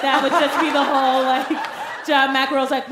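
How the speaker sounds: ordinary talk, nothing marked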